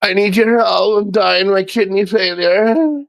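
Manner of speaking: high, slow, whiny fake-sick plea; mock pleading beggar. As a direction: ham up a sob-story plea for donations. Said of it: "and be like".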